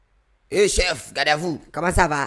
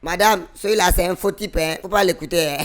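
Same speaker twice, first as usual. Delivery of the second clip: loud mocking warning; 6 semitones higher, 6 dB louder than usual.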